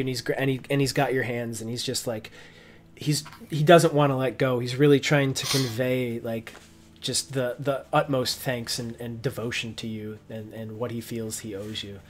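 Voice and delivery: normal talking voice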